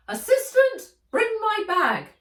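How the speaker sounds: high, loud bossy call; Fred calling out. A narrator's reading. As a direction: bark for your assistant from afar. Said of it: "called Fred".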